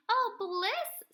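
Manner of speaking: blissful sigh. A narrator's reading. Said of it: "says the duck".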